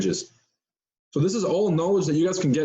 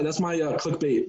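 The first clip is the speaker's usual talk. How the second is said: normal talking voice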